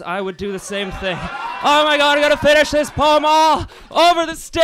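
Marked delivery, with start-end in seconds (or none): 1.6-4.6 high, loud frenzied shout. Acted. Slam poet shouting his climax across the stage. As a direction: bellow it out at full force. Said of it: "I'm sorry for shouting".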